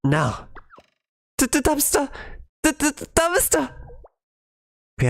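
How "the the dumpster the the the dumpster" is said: high, stammering alarm; panicked cry. Acted. Haruka warning her friends, high panicked stammer. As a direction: blurt it out in rising panic.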